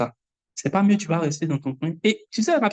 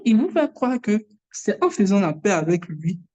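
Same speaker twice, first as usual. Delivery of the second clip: usual speaking voice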